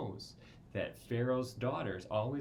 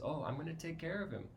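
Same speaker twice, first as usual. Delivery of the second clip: lightly voiced mother; 7 semitones higher, faster than usual. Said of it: "comes back and says".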